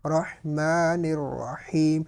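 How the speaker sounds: slow recited رحمن with the meem of رحمن drawn out; lengthened meem. Shown as a demonstration. Teacher demonstrating the long meem in رحمن. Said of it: "تھوڑا سا اس کو لمبا کیا میم کو".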